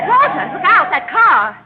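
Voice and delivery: loud, high cry of alarm; alarmed warning shout. Acted. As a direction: yell to stop the crash. Car about to crash.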